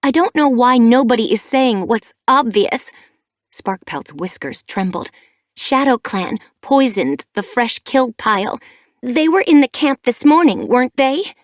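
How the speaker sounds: a grieving mate shouting suspicion; angry accusation. A narrator's reading. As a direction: throw the blame hard at ShadowClan. Grieving cat accuses ShadowClan angrily.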